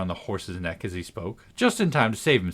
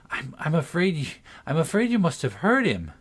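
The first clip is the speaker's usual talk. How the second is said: trembling voice; 6 semitones higher than usual. A narrator's reading. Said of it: "she said in a trembling voice".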